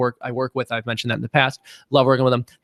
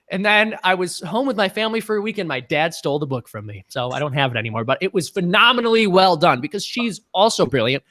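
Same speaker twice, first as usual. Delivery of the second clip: usual speaking voice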